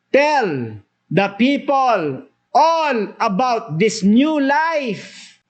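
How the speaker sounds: very slow and high, every word stretched; drawn-out proclamation. Acted. Preacher voicing the angel's charge, very slow and solemn. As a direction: proclaim it slowly like a herald. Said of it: "At sabi niya pa".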